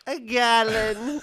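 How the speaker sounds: high, slow, drawn-out "gallon" rising; mock king. Friend imitating the king's exaggerated voice, slow and high. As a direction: stretch "A gallon." grandly. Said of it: "And he goes".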